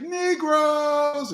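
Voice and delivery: very high, drawn-out call; mock hype-man call. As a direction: stretch the word like a stage entrance. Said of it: "[name] came out and said".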